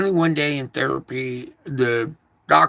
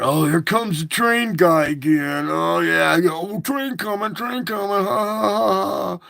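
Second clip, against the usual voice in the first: higher, louder taunting voice with fake laughs; mocking driver; 5 semitones higher, 3 dB louder than usual. Driver again taunting the man at the tracks, mocking laughter. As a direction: taunt the train guy again. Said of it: "every time I go down there".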